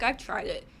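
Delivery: ordinary talk, nothing marked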